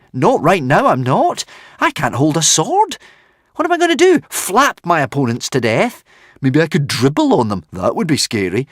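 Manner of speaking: mock his own uselessness; sarcastic protest. A narrator's reading. Hiccup protesting to the dragon, loud sarcasm.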